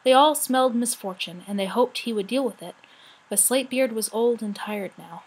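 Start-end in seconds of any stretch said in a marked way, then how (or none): none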